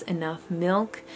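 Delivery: normal talking voice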